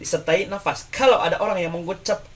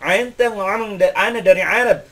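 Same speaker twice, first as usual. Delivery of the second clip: mock nasal ain; 4 semitones higher, 5 dB louder than usual. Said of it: "ainnya dia ngirung-ngirungkan".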